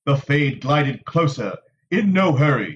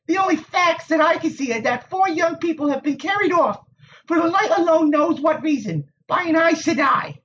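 put-on high, tart young woman's voice; prickly village Wisdom; 14 semitones higher than usual. A narrator's reading. Nynaeve to the Aes Sedai, tart and high. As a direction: needle him with a sharp tongue.

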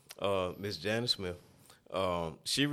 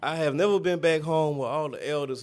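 normal talking voice

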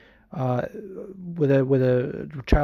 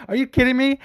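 imitating Chael; 8 semitones higher than usual.

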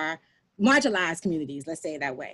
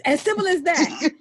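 laughing; 9 semitones higher, 8 dB louder than usual. Real, not acted. Laughing while recalling husband's advice.

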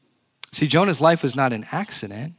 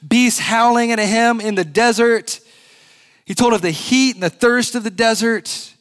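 rising excitement; 7 semitones higher, 5 dB louder than usual. Acted. Preacher to congregation, building the boy's perils with growing excitement. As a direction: voice climbing higher and louder with each danger.

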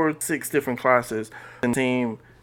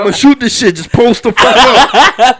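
loud, high mock bossy teammate; hammed-up shouted impression of a buddy; imitating a friend; 11 semitones higher, 13 dB louder than usual. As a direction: holler it like a cocky ballplayer, half laughing. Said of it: "That sound like him".

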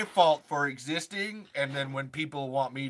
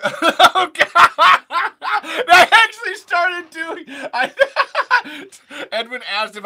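loud, high, helpless laughter through the words; laughing hard; 13 semitones higher, 17 dB louder, slower than usual. Real, not acted. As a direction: crack up while trying to explain. Cracks up at own mistake.

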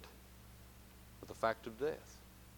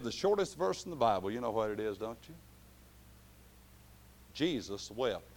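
normal talking voice

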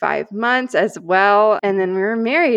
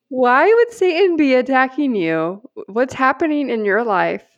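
high, loud retold disbelief, easing off; incredulous; 6 semitones higher, 4 dB louder than usual. Girlfriend answering fiancé's odd text about Satan, incredulous. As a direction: question him as if it makes no sense.

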